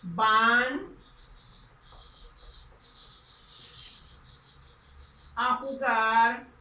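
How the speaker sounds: slow, emphatic model answer; slow long answer. Shown as a demonstration. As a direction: dictate the full answer slowly. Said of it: "Entonces diríamos así".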